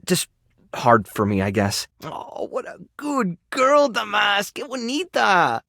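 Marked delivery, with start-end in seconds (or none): 2.0-5.6 high, slow coo to an animal; cooing pet praise. Acted. Rescue worker praising an animal while grooming it, cooing. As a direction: croon praise to a beloved pet.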